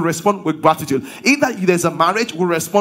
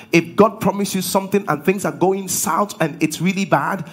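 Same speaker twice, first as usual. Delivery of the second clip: ordinary talk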